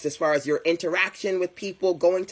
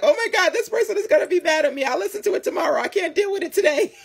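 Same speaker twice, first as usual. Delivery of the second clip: much higher, quick, squeaky panicked voice; mock dread; 12 semitones higher, faster than usual. Avoiding a voicemail, in a high squeaky panic. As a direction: squeal it like you're dodging the voicemail. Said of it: "I'm like".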